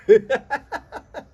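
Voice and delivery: sudden high burst of laughter. Real, not acted. Vlogger laughing at pedantic commenters, high burst. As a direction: laugh out loud in disbelief.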